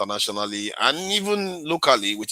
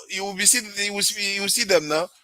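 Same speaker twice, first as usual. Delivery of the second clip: ordinary talk, nothing marked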